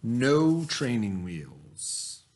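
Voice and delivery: put-on reading a picture book aloud to children; story reading. A narrator's reading. Teacher reading a bike story to her class, storytelling voice. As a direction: tell it gently, like story time.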